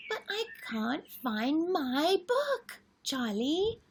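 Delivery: put-on high excited little girl voice, pleading; little sister Lola. Lola begging Charlie for her favourite book, high and pleading. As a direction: plead like an eager small girl. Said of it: "Lola says".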